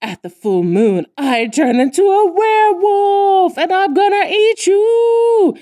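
slow, rising to a loud high growl; spooky werewolf threat. Retold or imitated. Bully taunting a scared girl, building to a loud menacing howl. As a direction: scare a child with a monster voice. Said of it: "She's like".

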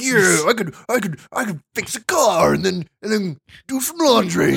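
mountain man voice. Retold or imitated. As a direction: boast like a lumberjack. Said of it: "You're like".

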